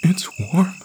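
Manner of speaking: put-on very high little child's voice; small high voice, mildly faltering; stammering boy. A narrator's reading. Tearful boy receives warm bear, high and faltering. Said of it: "he stammered".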